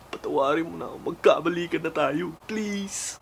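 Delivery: high, soft begging voice; hammed-up tearful begging; mock pleading. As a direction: plead for forgiveness, trailing up into a tiny please. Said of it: "yung mukha niyang nagmamakaawa".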